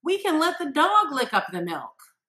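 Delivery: a lightly voiced child suggesting the dog; child's answer. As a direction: pipe it up like an eager kid. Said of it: "if your child answers".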